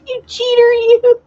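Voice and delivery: very high, loud squealed teasing accusation; mock insult. Teasing Renee about being called a cheater, high mock insult. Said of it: "She was insulting you".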